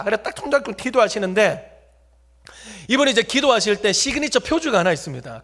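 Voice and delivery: ordinary delivery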